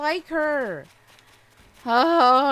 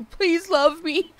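more pleading plea, pitched up; mock begging, higher; 5 semitones higher, 4 dB louder than usual. Real, not acted. Streamer begging her villagers again, pitched up and pleading. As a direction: beg harder, playfully. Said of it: "begging my Animal Crossing characters".

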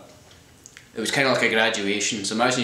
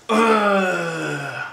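loud disgusted ugh, pitched up; real exasperated ugh; frustrated groan; 7 semitones higher, 3 dB louder than usual. Real, not acted. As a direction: groan at the memory. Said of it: "frustrated groan".

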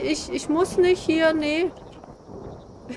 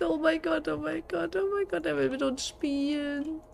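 softer, repeated under breath; hushed dread; 8 dB quieter than usual. Real, not acted. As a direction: murmur it in dread. Creepy toy wants to play. (leise, voller Grauen)

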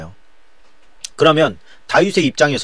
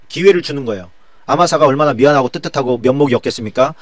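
normal talking voice

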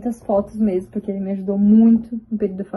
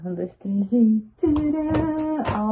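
slow, higher countdown to a ta-da; playful countdown; 5 semitones higher, much slower than usual. Real, not acted. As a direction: build up the reveal playfully. Countdown to photo reveal.

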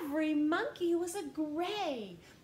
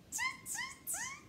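very high tiny tutting; high squeaky monkey voice; squeaky monkey tut; 7 dB quieter than usual. Acted. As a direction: chatter it like a cheeky monkey. Monkeys answer the peddler.

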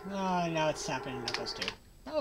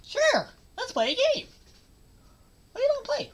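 high squeaky cartoon voice; much higher toy-like voice; character voice. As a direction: voice the little game character playfully. Voicing game characters in high voice.

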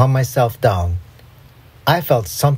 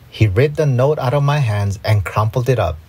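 ordinary delivery

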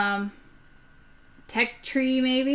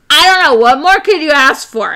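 excited; 5 semitones higher, 9 dB louder than usual. Real, not acted. Enthusiastic outburst.

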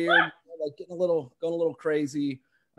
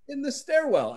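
amused disbelief; 10 semitones higher than usual. Real, not acted. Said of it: "That's what he said to me".

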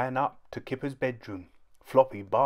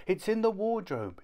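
very high child voice, faked panic; a small boy pretending a monster will eat him; little boy's fake alarm; 10 semitones higher than usual. A narrator's reading. Kipper fakes monster fright to Mum, very high.

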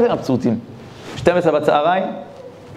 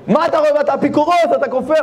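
angry rebuke; 10 semitones higher than usual. Acted. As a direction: berate him as a heretic, much higher. Rabbi accuses again.

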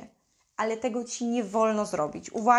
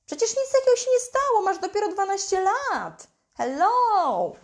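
mock scoffing parent, much higher and fast; imitating a parent who shrugs off the problem; mock dismissive brush-off; 7 semitones higher than usual. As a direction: wave the child's hurt away with a scoff. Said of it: "nie wolno ci zaprzeczać, że nic się nie stało".

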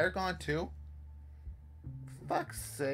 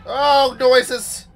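loud, high cry of alarm; alarmed shout; 9 semitones higher, 17 dB louder than usual. Real, not acted. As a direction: shout it in fright. Startled by scary noises.

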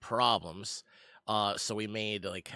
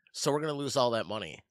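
usual speaking voice

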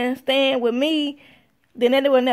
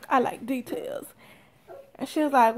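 ordinary talk, nothing marked

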